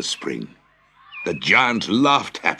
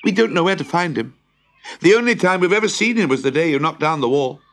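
put-on much higher, brisk child voices; children's voice; 10 semitones higher, 4 dB louder, faster than usual. A narrator's reading. Children telling the giant about the boy, high-voiced. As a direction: pipe up high like children answering.

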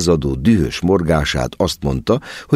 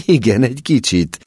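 happy; 6 semitones higher than usual. A narrator's reading. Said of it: "kiáltott mama boldogan".

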